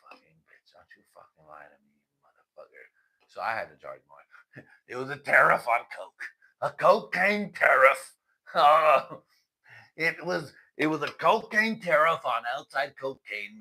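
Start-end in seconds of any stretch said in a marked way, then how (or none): 0.1-2.9 whispered cursing. Retold or imitated. 4.9-13.6 laughing loud. Real, not acted. Laughing at own joke.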